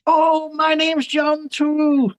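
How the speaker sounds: very high, slow put-on voice. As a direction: ham up the singer's cheerful surprise.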